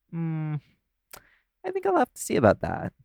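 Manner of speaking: teasing lilt that jumps up high and fast; flirty tease. Acted. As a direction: toy with them, pretending to be unsure. Flirty teasing of the new secretary.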